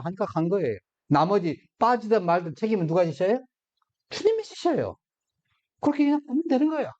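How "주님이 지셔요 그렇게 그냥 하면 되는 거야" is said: much higher, quick, lifted voice; high exclamation. Acted. Preacher tells the congregation the Lord bears it, high and quick. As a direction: fling the answer up high and fast. Preacher answers his own question.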